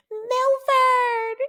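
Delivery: very high, loud screech of a name; imitated scream. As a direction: shriek it to summon a husband. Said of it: "And she screams".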